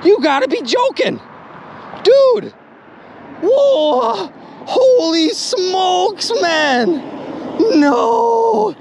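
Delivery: high-pitched, stretched cries of amazement; thrilled, can't believe the luck; euphoric disbelief. Real, not acted. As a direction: squeal with real shocked excitement. Euphoric shock at finding a gun.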